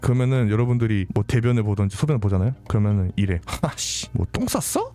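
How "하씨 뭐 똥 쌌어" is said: high, slow mock disgust; contemptuous. Abuser faced with a soiled diaper, acted high-pitched contempt. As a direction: sneer it at someone helpless, pitched up. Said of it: "멸시하는 듯한 그런 말투를".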